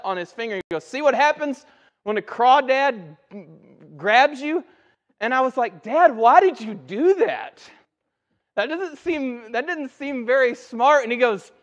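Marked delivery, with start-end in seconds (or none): 0.8-4.6 raised, louder retold dad voice; mock lesson, pitched up and loud; lightly acted dad. Dad showing son his cut finger, lightly acted lecture. 5.8-11.0 higher, louder retold questioning; incredulous child. Son to dad after the pinch, mock incredulity.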